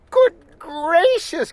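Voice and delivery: high startled gasp of words. A narrator's reading. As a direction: gasp it out in shock. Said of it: "gasped my sister".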